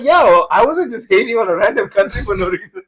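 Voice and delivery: high, loud, laughing protest; retold indignant protest. Retelling a reply to a coworker about Sri Lanka, high mock indignation. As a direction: protest loudly, half amused.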